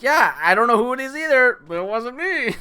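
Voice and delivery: high, loud defensive protest; incredulous. Retold or imitated. Telling the boss it wasn't him, high indignant. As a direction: insist it wasn't you, indignant.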